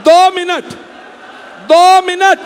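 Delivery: high, loud plea to the crowd. Real, not acted. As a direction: raise the voice over the crowd. Pleading with the crowd. (भीड़ से ऊँची, ज़ोरदार मिन्नत)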